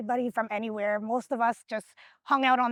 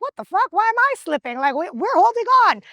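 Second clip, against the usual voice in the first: higher, panicked disbelief while slipping; mock panic as the rope slides away; alarmed outburst; 6 semitones higher, 6 dB louder than usual. Retelling tug of war slipping, alarmed and higher. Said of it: "I'm like".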